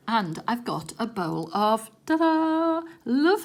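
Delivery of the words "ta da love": loud bright showman's ta-da; ta-da reveal. Real, not acted. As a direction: present it with a flourish. Reveals the bowl of sweets.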